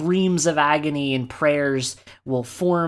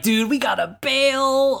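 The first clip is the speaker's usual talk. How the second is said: slacker Sid; 11 semitones higher than usual. Retold or imitated. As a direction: play Sid as a surfer bro wanting out. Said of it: "Sid's like".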